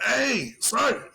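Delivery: retelling how he called out to his sergeant; calling out. Retold or imitated. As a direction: hail the sergeant from the doorway.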